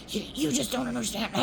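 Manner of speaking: lightly acted irate grumbling; mock angry. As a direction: imitate an angry phone rant under your voice. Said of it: "And he was so angry".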